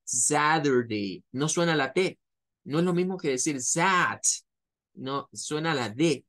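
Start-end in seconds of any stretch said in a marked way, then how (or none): 0.0-1.2 Saturday slow, ZA-dur-day, T as D. Shown as a demonstration. Teacher imitating an American saying Saturday. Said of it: "con ese zad".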